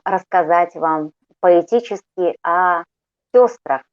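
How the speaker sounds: ordinary talk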